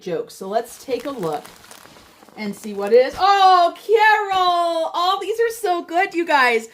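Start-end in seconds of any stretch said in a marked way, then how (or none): 3.2-6.7 high, loud delighted squeal of thanks. Real, not acted. Woman opening her friend Carol's gift, delighted. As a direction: gush with real delight, pitched high.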